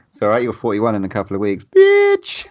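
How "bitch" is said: teasing shriek. Real, not acted. Teasing friend about turning forty-one.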